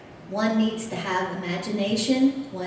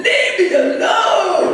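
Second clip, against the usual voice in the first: slow, loud, higher shouted pig voice; talking pig; 9 semitones higher, 7 dB louder, slower than usual. Retold or imitated. Pig whacked on the butt yells at the guide, slow loud pig shout. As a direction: belt it out like a grumpy pig being whacked. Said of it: "The guy hit the pig again".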